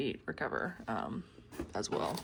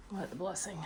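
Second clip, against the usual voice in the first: normal talking voice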